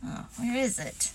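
soft, fretful whisper. A narrator's reading. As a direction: whisper it while searching.

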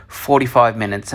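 ordinary talk, nothing marked

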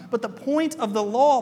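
usual speaking voice

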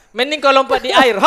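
high, loud mock heckler's yell; imitating someone yelling out; retold yell. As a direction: shout it like a heckler, half laughing. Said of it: "dia teriak, dia bilang".

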